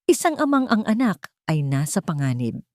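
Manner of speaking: ordinary delivery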